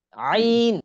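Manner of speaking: throaty Arabic ain demo; deep throat ain. Shown as a demonstration. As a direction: push আইন up from the throat. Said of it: "একদম ভিতর থেকে আসবে".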